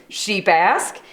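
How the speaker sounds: ordinary delivery, nothing marked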